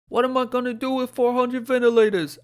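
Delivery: loud, high, hammed-up New York governor; bad New York accent. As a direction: overplay a blustering New Yorker. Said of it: "that's the worst New York accent you've ever heard".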